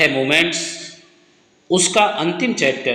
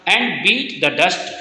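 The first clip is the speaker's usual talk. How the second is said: slow dictation; 2 semitones higher, much slower than usual. Shown as a demonstration. Teacher dictates answer slowly.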